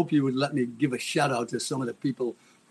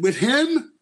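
higher and louder, a ringing challenge; loud echoing ghost voice; 9 semitones higher, 4 dB louder than usual. Retold or imitated. Morrison's ghost questioning him, higher and louder. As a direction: boom it out like a voice from nowhere. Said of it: "That loud, really echoing".

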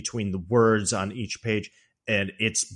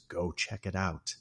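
much softer, sly suggestion; mock sinister husband goading his wife; creepy calm; 3 semitones lower, 7 dB quieter than usual. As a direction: coax her to a trap, quietly. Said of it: "that creepy smile crawls across his face".

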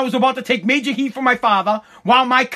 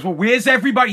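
ordinary talk, nothing marked

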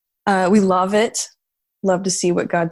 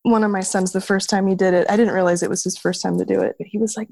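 normal talking voice

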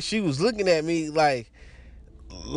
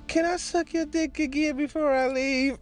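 lightly voiced woman, higher pitch; girl's request; 9 semitones higher than usual. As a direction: imitate her asking, pitched up. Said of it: "she was like".

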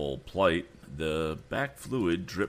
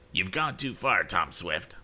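mild anger; 3 semitones higher than usual. A narrator's reading. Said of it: "ordered Andy".

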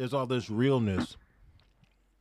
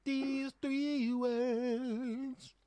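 very high, slowly stretched mock voice; mock high drawl; much slower than usual. Mocking his answer.

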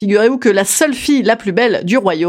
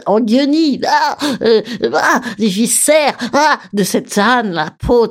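disgusted; 2 semitones higher, slower than usual. Real, not acted. Disgust at the bloody hide.

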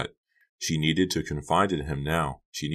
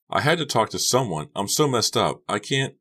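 put-on higher, upset teen girl; distressed; 6 semitones higher, 3 dB louder than usual. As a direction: voice of a girl falling apart. Becca distressed, confiding.

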